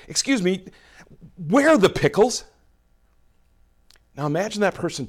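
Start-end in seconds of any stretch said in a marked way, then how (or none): 0.1-2.4 asked a little higher, with an impatient rise; a hurried shopper, lightly voiced as fed up; mildly exasperated. Shopper to store worker, a touch of exasperation. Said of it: "you're a little exasperated".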